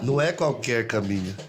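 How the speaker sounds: ordinary talk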